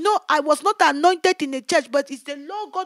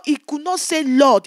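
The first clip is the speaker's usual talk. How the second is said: usual speaking voice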